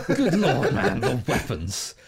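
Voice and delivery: slowed down, laughing through it. Real, not acted. Host laughing about the game's weapons. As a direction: savour the words while laughing.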